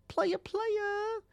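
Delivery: squeaky high falsetto 'player, player'; mock player voice. Bragging to listeners, in a squeaky high falsetto. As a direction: toss it off in a playful falsetto. Playful self-hype as a player.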